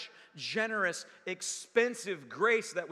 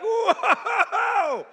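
much higher, much louder cry; startled whoa; 12 semitones higher, 12 dB louder than usual. Retold or imitated. Stepping into a strong river, loud startled exclamation. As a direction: caught off guard by the current. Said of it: "You're just like".

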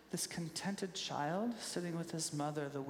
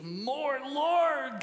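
high, loud, straining cry of longing; angsty "More Lord"; 7 semitones higher, 6 dB louder than usual. Retold or imitated. Worshipper straining for more of God, angsty cry. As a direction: groan it out like a desperate worshipper. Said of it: "this sort of angsty".

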